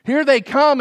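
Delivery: high, panicky voice of the frightened people; freaking out. Retold or imitated. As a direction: act out the people's alarm. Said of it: "freak out like the co-pilot".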